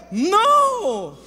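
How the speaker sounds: loud, high, long-drawn refusal; emphatic denial. Real, not acted. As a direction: cry it out, swooping up and down. Responde su propia pregunta retórica. (negación rotunda, alta y alargada)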